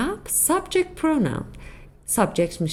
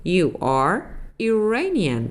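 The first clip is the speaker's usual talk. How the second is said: slow model sentence; much slower than usual. Shown as a demonstration. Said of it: "تو ایرانی هستی".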